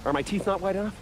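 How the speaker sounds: normal talking voice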